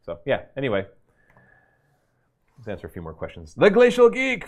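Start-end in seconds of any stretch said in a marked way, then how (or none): 3.5-4.5 high, loud shout of a name; big, booming show-host call; announcer call-out. Real, not acted. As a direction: belt out the handle like an announcer. Calls out next questioner's handle.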